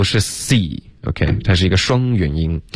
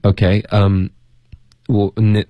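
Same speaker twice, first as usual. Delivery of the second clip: ordinary delivery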